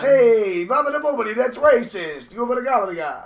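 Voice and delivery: high, loud cartoon Italian; exaggerated Italian-American indignation; mock Italian accent. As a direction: protest in hammed-up Italian gibberish. Mocking Italian speech.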